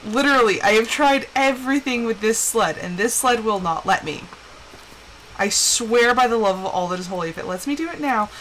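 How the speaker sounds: ordinary talk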